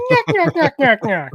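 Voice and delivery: high, loud cartoon yammering; comic high-pitched yelping; cartoony nyah-nyah noise. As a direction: squawk it out high, then ease down.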